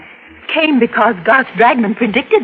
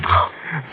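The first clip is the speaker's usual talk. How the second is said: horrified gasp; 8 semitones lower, 7 dB quieter than usual. Acted. Horrified at vision of murder.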